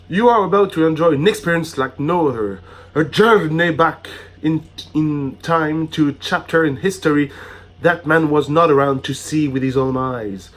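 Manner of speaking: a touch of theme park announcer; formal, lifted welcome read; grand welcome speech. Acted. Reads welcome letter aloud.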